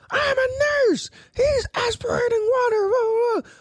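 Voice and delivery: very high, hammed-up mock shouting; shrieking old lady. Mocking the nurse's tirade, high shrieking nag. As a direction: screech it, running into babble. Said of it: "this fucking old lady".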